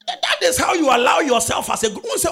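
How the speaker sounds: loud, high preaching cry; preaching shout. Real, not acted. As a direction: cry it out to the church.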